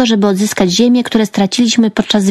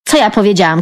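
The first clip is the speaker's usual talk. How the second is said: ordinary delivery, nothing marked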